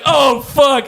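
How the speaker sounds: high-pitched dismayed exclamation; caught-out alarm, acted out; retold panic. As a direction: cry out in mock panic. Retold panic at own slip.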